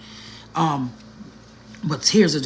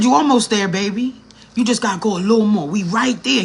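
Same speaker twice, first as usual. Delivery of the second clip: put-on encouraging old man; 5 semitones higher, 5 dB louder than usual. Voicing the kind old man.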